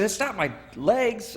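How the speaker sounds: high, slow insistent correction; urgent correction. Retold or imitated. Correcting the Marines lifting him.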